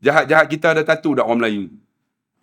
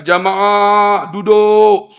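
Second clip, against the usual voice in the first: long, raised call to the group; drawn-out, louder command; 4 semitones higher, 4 dB louder, much slower than usual. Retold or imitated. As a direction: call out an instruction to pilgrims. Guide calls group to sit.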